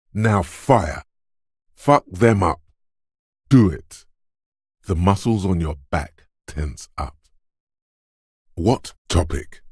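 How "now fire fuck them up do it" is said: raised, higher-pitched orders; urgent attack command. Acted. Commander ordering someone to open fire, forceful command.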